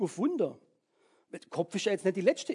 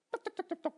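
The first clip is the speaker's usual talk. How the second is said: knocking sound; 14 semitones higher, 3 dB quieter, faster than usual. Pretend. Imitated knocking or ticking.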